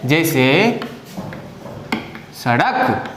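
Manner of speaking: सड़क said low and deliberately; example word. Shown as a demonstration. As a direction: give सड़क as a careful model word. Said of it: "example word for class".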